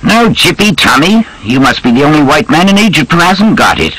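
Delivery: high-pitched, chummy army major; British officer. Acted. Major marvelling at his drinking companion, high chummy officer voice.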